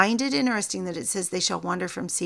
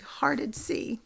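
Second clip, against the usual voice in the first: words breaking into a whisper; choked up; 5 semitones higher, 5 dB quieter than usual. Real, not acted. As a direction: voice catching with real emotion. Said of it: "I get emotional sometimes".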